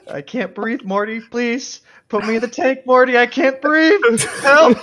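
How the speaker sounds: high panicked gasping plea; mock drowning panic; Rick gasping. Anchovy Rick gasping joke.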